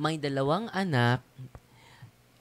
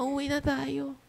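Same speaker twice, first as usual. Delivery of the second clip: much higher, whiny voice listing her pains; complaining pregnant woman; 12 semitones higher than usual. Retold or imitated. Pregnant woman begging her family to go home, high and whiny. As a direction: plead like a sick woman begging to leave. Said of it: "Sabi niya".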